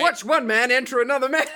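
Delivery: laughing mock film tagline; mock trailer voice. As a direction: pitch it like a cheesy movie announcer. Mock movie trailer pitch.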